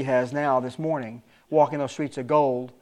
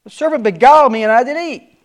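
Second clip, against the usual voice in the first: much higher, louder pleading excuse; Eve blaming; 10 semitones higher, 10 dB louder than usual. Acted. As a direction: point at the serpent, high and loud. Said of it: "And the woman said".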